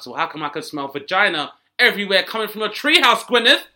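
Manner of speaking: mock yell at Gwyneth from a treehouse; accusing call. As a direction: call it across the trees.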